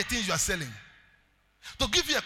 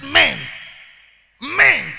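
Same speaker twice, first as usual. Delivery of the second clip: higher and louder on men; emphatic shout; 7 semitones higher, 14 dB louder than usual. Acted. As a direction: punch the word men. Emphasising the giver.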